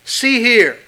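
loud, slow, stern preacher's warning. Acted. Preacher to the congregation, voicing Jesus in a loud stern warning. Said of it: "Jesus sternly warns them".

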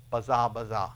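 baza, baza said as a repeated Hebrew word. Shown as a demonstration. Preacher voicing the Hebrew word baza, carefully. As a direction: sound out baza twice, falling. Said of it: "The repetition of the word".

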